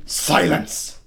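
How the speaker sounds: sudden loud, high, breathy burst; commanding shout. Acted. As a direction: shout it out as one sharp command. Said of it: "No, that's not right either".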